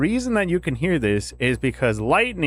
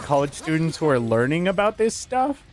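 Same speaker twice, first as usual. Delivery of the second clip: ordinary talk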